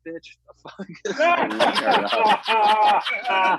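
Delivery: guy snapping back at his date, mock outrage; indignant yell. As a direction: blow up at her in disbelief. Acted retort in a joke.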